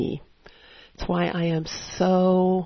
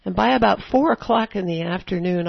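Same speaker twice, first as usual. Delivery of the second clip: normal talking voice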